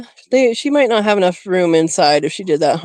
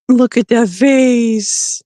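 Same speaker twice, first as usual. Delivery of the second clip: slow, higher doting coo; 4 semitones higher, much slower than usual. Real, not acted. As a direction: linger lovingly on each word. Doting on the rabbit.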